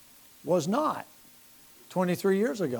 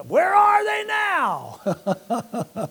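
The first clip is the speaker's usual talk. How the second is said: much higher, louder, slowly stretched laughing question; mock incredulous shout; 11 semitones higher, 6 dB louder, much slower than usual. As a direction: ham up the skeptic's jab. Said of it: "you say".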